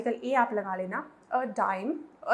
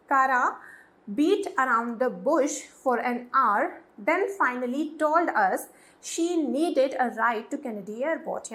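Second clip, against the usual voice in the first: very slow, clear dictation of the sentence; slow teacher reading; slow example reading; much slower than usual. Shown as a demonstration. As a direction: read it word by word for learners. Reads example slowly.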